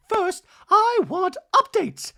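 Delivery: hammed-up high Dorit; mock Dorit. Host voicing Dorit at lunch, high and loud. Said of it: "Dorit's like".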